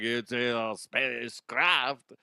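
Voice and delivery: slow, hushed, dramatic announcement of a spacecraft; mocking scientist impression. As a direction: drawl it out like a hyped-up scientist. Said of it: "This is my impression of him".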